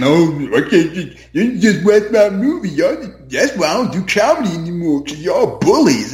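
hammed-up put-upon filmmaker whining about bullies; mocking the director. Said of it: "They get".

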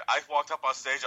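normal talking voice

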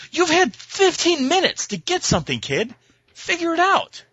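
high, put-on voice of rude parents; mock stammering parents. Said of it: "stammering jackasses ... going".

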